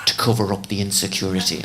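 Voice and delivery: a light put-on Northern Irish therapist; blunt therapist in a loose Northern Irish accent. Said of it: "And she's Northern Irish".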